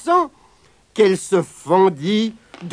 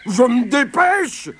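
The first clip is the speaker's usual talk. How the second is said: a lightly voiced grumbling bear, higher and slow; 6 semitones higher than usual. Ours answers Lapin's nagging with a grumble. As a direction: grumble it back, dragging the words. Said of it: "bougonnait compère Ours".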